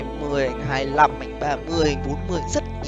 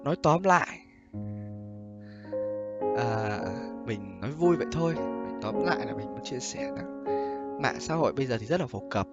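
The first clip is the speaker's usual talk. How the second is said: pillow talk; 10 semitones lower, 17 dB quieter than usual. Real, not acted. Soft closing advice.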